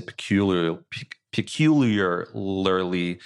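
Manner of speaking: stumbling over "peculiarly", dragging it out slowly; tongue-tied. Real, not acted. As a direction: trip on "peculiarly", restart and stretch the syllables. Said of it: "we're both getting tongue-tied".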